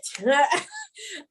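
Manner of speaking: a short high burst of laughter; embarrassed laugh. Real, not acted. Sheepish laugh at admitting she was not ready. Laughs at her own confession.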